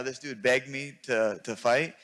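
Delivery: normal talking voice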